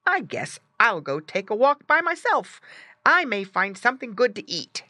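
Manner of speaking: high piggy character voice; little pig. A narrator's reading. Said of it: "grunted Squinty".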